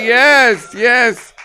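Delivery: normal talking voice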